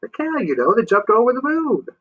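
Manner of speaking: put-on a sleepy old man mishearing; deaf old man. Moon explains.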